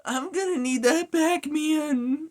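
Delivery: very high, loud mock hero voice; Miles Morales. Gamer mocking a superhero he finds cringe, high-pitched impression. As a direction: push it way up high, badly faking the character. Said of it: "I can't do the Miles voice".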